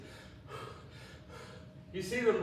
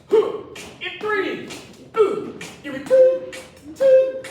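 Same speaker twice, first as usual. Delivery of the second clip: high, loud pumped-up rep count; excited countdown; 8 semitones higher, 8 dB louder, slower than usual. Acted. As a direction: whoop between counts to fire them up. Counting down reps, whooping.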